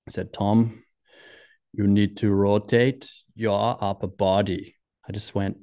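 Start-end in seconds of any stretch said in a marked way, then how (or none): 0.3-4.8 slow, deliberate imitated Austrian accent; measured advice in a put-on Austrian accent; bad Austrian accent. Skier gives his one tip, slow bad Austrian accent. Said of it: "That's a really bad Austrian accent".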